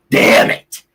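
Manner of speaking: loud, explosive curse; real frustrated anger, shouted; really pissed off. Real, not acted. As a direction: burst out angrily at the loss. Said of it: "I'm, like, seriously really pissed off".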